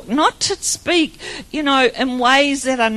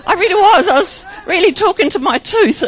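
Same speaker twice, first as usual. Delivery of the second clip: loud, high, amused confession; tickled by your own story; laughing admission; 6 semitones higher, 6 dB louder than usual. Real, not acted. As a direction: insist on it while breaking into laughter. Laughs at her own dentist story.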